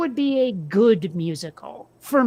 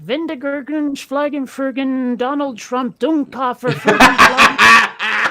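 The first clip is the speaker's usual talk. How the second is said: mock German gibberish, building to a laughing shout; rattling off nonsense German words, hammed up; fake German accent; 3 semitones higher, 7 dB louder, slower than usual. Mocking German gibberish to a friend, fake German accent. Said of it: "a fakey German voice".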